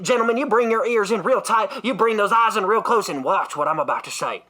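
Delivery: normal talking voice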